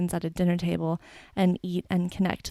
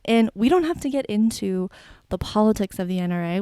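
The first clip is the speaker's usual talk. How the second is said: ordinary delivery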